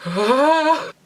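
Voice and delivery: sharp, high, loud yelp of fright; a sudden scared cry, recalled; high startled "ah" of fright; startled gasp. Retold or imitated. Patient threatening with a needle.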